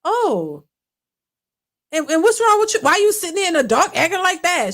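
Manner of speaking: high-pitched mock scolding; imitating an impatient girlfriend; retold demanding girlfriend. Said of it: "And she was like".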